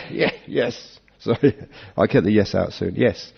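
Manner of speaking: ordinary talk, nothing marked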